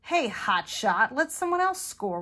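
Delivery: loud, high-pitched acted complaint; cranky storybook teammate. A narrator's reading. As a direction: call out the show-off crankily. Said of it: "His teammates were cranky".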